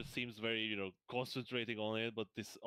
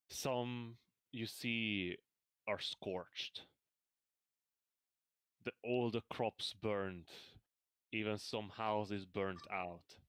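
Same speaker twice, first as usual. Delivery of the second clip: slow, measured scene setting; game master narration; 3 semitones lower, slower than usual. A narrator's reading. Game master describes the scorched farms, slow narration. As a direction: paint the burned farmland slowly.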